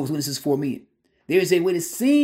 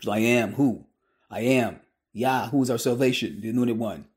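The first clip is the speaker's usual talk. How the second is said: ordinary talk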